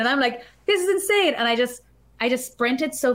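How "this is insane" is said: amazed. Retold or imitated. Amazed at her finish time.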